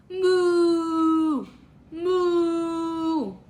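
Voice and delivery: long, level imitation moo; cow moo. Teacher showing a toddler the cow's sound, long moos. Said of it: "What sound does a cow make?".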